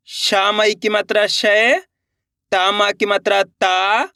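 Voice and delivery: slow spelling chant, "शय" and "ता" stretched and rising; repeat-after-me drill. Shown as a demonstration. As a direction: draw out "शय" and "ता" for learners to repeat. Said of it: "मेरे साथ साथ इनको repeat करते जाना है". (धीमा अभ्यास, "शय", "ता" खींचकर)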